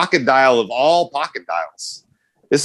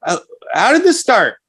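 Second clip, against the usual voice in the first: surprised question; 10 semitones higher than usual. Retold or imitated. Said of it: "And I'm like".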